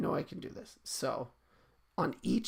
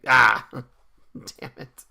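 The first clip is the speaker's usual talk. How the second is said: laughing; 13 dB louder, much slower than usual. Real, not acted. Laughing at chat's joke.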